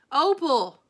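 high, loud call. A narrator's reading. Preacher calling Opal from the porch, loud. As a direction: call her name from the porch. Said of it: "I heard the preacher call".

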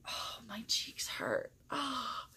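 breathy whisper to herself; whispered aside. Real, not acted. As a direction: drop to a whisper about sore cheeks. Aside about sore cheeks.

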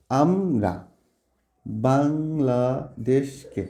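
"আমরা বাংলাদেশকে" very slowly, pausing after each syllable; teacher sounding out each syllable of "আমরা বাংলাদেশকে". Shown as a demonstration. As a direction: split "আমরা বাংলাদেশকে" into separate drawn-out syllables. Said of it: "যতটুকু আমরা উচ্চারণ করে থেমে যাচ্ছি".

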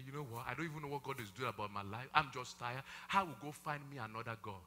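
hushed, tired voice of someone giving up; quiet defeated grumble; weary despair. Pretend. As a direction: mutter it like you're done with God. Discouraged believer voiced quietly.

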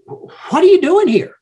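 high, pointed challenge; incredulous confrontation. Acted. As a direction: demand it, voice leaping up high. God confronting Elijah, tough love.

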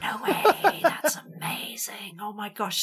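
hushed, slow disbelief; awed whisper. Retold or imitated. Fan learns her idol is there, awed whisper. Retelling stunned reaction to idol's presence.

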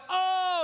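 a high, loud, wailing oh of grief; anguished cry. Acted. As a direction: cry out like a father hearing news of his dead sons. David hears sons are dead.

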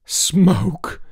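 high, aghast exclamation; cried out. A narrator's reading. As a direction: echo it in outrage. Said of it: "George cried".

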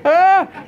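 high, loud alarmed yelp; a sudden high, loud cry of fright; startled yelp. Retold or imitated. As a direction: yelp as the danger sinks in. Reacts to hearing pig is wild.

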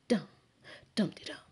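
plain reading voice

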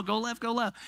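jokey self-instruction at the wheel; lightly hammed-up driving practice; mock racing driver. Mock nascar driving lesson.

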